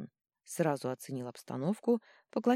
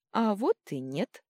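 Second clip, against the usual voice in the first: slow, amused smirk; 5 semitones higher, 5 dB louder than usual. A narrator's reading. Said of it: "хмыкнула Никорин".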